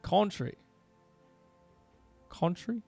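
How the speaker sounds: "country" softly tested as "KON-tree"; odd Australian "country". Shown as a demonstration. Streamer puzzling over "country", soft Australian "KON-tree". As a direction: try "country" with an "on" vowel, quietly. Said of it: "can't say that with an Australian accent".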